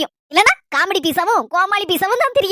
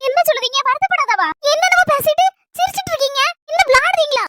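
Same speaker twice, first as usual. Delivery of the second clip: much higher, sharp accusing question; indignant outburst; 7 semitones higher than usual. Acted. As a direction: demand to know if he's joking. Husband laughs after upsetting her.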